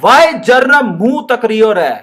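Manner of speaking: slow Rajasthani scolding madam. Acted. Madam scolding staring Jarnab in Rajasthani accent. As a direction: scold the boy in broad Rajasthani. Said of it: "it's a Rajasthani".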